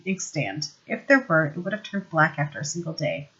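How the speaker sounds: ordinary delivery, nothing marked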